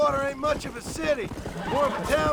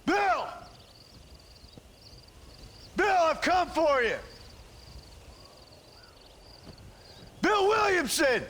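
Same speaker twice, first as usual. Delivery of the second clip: calling out; 3 semitones higher than usual. Acted. Calling an outlaw out of a fort.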